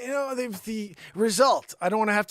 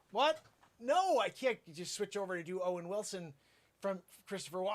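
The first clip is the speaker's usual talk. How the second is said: ordinary delivery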